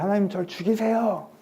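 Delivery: a hammed-up begging voice; mock pleading. Elijah begs God to take his life, high and pleading.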